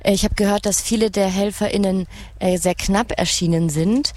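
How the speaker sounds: ordinary talk, nothing marked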